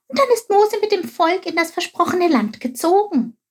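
high-pitched excited little figure; eager Chilp. A narrator's reading. Chilp guesses the story's ending to Quark, high eager voice. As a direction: jump ahead to the happy ending, high-voiced.

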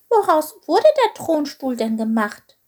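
high, small, inquisitive voice; curious question. A narrator's reading. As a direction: ask curiously, pitched well up. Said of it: "fragte Chilp neugierig".

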